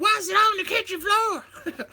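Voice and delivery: high mock nagging wife voice; hammed-up wife asking about the kitchen floor; mimicking wife. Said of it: "my wife's like".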